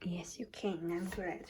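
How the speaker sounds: soft ASMR whisper. Real, not acted. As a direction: breathe the words, unvoiced. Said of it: "enjoy the A S M R".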